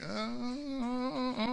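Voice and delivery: stammering dad. Retold or imitated. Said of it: "the dad's like".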